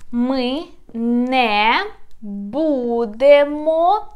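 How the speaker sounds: Ми не будемо said slowly and clearly; teacher reads Ми не будемо slowly, syllable by syllable; Ukrainian spoken slowly. Shown as a demonstration. Teacher modelling Ukrainian slowly for students. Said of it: "pay attention".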